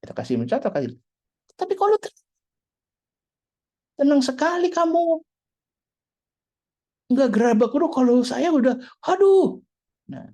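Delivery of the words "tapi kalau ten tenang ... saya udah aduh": high, loud, astonished exclaiming; amazed onlooker. Acted. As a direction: marvel loudly at someone so calm. Orang heran melihat ketenangan. (heran dengan suara tinggi dan keras)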